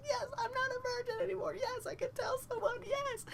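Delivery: very high squealing celebration; hammed-up triumph. Teen eager to tell his friend, high gleeful cheering. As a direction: squeal it in giddy victory. I can't wait to call my friend.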